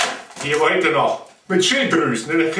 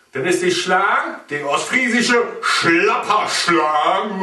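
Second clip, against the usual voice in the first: ordinary talk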